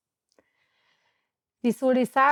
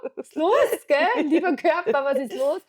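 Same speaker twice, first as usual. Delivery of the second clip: higher, mock-indignant call to her own body; 5 semitones higher, 3 dB louder than usual. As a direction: scold your own body, half joking. Spricht empört zum eigenen Körper. (gespielt empört, höher, zum eigenen Körper)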